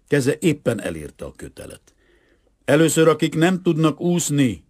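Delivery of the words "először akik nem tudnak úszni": raised, higher commanding call. A narrator's reading. Boy directs who climbs the rope first, calling out. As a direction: call out the order to a group. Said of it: "kiáltotta".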